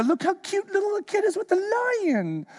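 high, cooing sarcastic voice; high mock-adoring coo. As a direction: gush sarcastically over the video. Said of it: "YouTube that and go".

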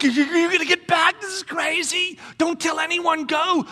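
frantic fearful voice; hushed panic. Pretend.

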